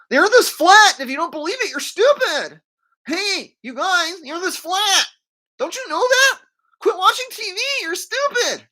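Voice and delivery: high-pitched mock yelling; mocking street berater. Flat earther berating people on the street, high mocking yell. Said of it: "he goes out and berates people".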